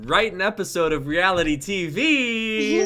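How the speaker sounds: drum roll reveal. Acted. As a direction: announce it like a game show host. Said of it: "drum roll, please".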